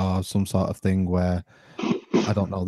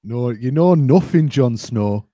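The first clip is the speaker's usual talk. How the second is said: mock Northerner; 2 semitones higher, 5 dB louder, slower than usual. Teasing a co-host as Jon Snow, mock Northerner. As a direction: draw it out as a loud Northerner line. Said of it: "you sound like one of the Northerners".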